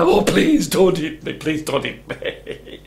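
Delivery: loud plea trailing into a whimper; frightened goat, last plea drawn out; pleading goat. A narrator's reading. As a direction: beg for your life, fading away. Goat begs troll for its life.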